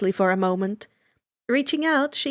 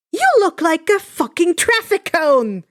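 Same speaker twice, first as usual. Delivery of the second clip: loud, higher insult, shouted down the hall; arguing yell; 6 semitones higher, 6 dB louder than usual. Acted. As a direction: bark the insult at him. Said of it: "Mitsuki's voice argued back".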